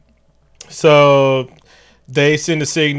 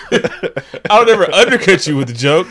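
higher, laughing through the line; laughing protest; 5 semitones higher, 5 dB louder than usual. Real, not acted. Denying to cohost while laughing. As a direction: giggle the denial.

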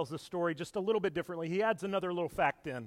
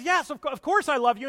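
much louder and higher, pleading; insistent Peter; 8 semitones higher, 9 dB louder than usual. Acted. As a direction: protest it, voice rising. Peter insists on his love.

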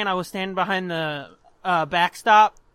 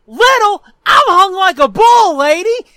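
high, very loud squawking retort; outraged movie-parrot imitation at full volume; indignant comeback; 9 semitones higher, 11 dB louder than usual. Boy to the scolding girl, loud indignant squawk. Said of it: "And I went".

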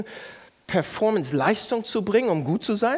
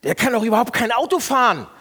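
louder, higher, contemptuous judgment; scornful complaint; 6 semitones higher, 6 dB louder than usual. Shown as a demonstration. Pastor acting out judging another driver. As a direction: sneer at the bad driver. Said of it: "Kennt ihr das?".

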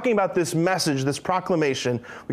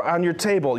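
ordinary talk, nothing marked

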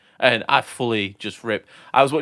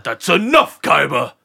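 Kaiba impression; 3 semitones higher, 6 dB louder, slower than usual. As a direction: bark it like a haughty anime rival. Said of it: "I used to do the Kaiba thing".

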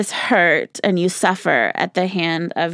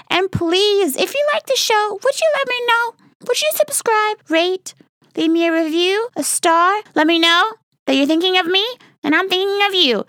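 cutesy pleading; 12 semitones higher than usual. Real, not acted. Begging listeners to subscribe.

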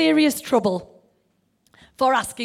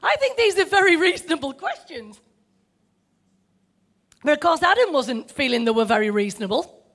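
ordinary talk, nothing marked

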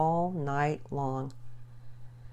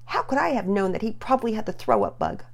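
put-on defensive boy; 7 semitones higher, 3 dB louder than usual. Boy defends himself in quarrel.

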